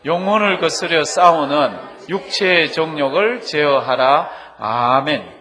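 loud, slow, measured reading aloud; recitation of scripture. Real, not acted. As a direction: read the verse out loud for all to join. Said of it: "다 같이 봉독하십니다".

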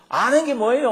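높고 크게 쏘아붙이듯; 짜증 내며 높게 따지는 약혼녀; 쏘아붙이기. Retold or imitated. 약혼녀가 쏘아붙임 재연. (언더우드에게 따지는 약혼녀, 높고 크게 쏘아붙임)

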